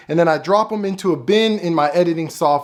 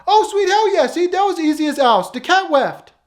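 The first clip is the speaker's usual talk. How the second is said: high, loud cartoon voice, gleeful; Tyrone; 12 semitones higher, 4 dB louder than usual. Tyrone glad the cat left, high and gleeful. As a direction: crow with delight in a much higher voice. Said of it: "here goes Tyrone".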